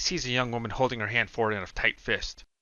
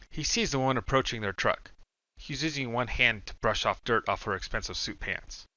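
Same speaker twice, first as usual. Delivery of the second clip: ordinary delivery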